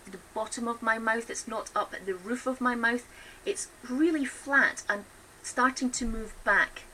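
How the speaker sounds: normal talking voice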